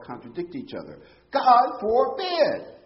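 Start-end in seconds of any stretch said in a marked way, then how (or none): 1.3-2.8 slow, loud, high exclamation; strongest expression. Acted. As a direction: stretch out God forbid with full force. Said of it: "That's his strongest expression".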